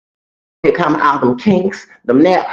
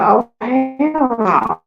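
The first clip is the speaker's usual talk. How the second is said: high, slow, drawn-out mock outrage; indignant refusal; 11 semitones higher, much slower than usual. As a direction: stretch it out high in retold disbelief. Retold indignant reaction to the screaming.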